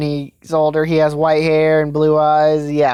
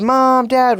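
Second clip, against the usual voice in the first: higher-pitched cry at little extra volume; understated retold scream; mock tearful scream; 6 semitones higher than usual. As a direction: call out for parents in a restrained scream. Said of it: "starts crying up and screams".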